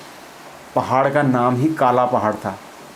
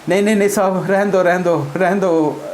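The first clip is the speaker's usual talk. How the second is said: higher, pleading cries of stop; mock pleading; 6 semitones higher than usual. Beaten soldiers begging the officer to stop, in hammed-up pleading. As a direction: beg to be spared, half laughing.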